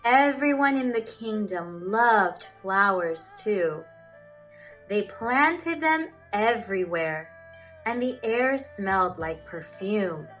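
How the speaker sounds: usual speaking voice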